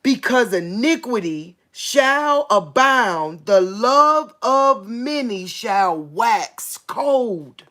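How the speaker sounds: loud, slow, drawn-out preacher's reading; forceful, stretched-out Bible proclamation; preaching scripture. Real, not acted. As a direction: proclaim the verse, leaning hard on each word. Reads scripture aloud with force.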